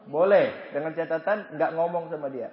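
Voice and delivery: normal talking voice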